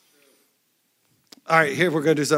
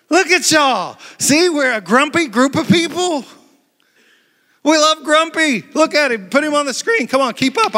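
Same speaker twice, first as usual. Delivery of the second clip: playful teasing; 10 semitones higher, 5 dB louder than usual. Real, not acted. Teasing the grumpy crowd.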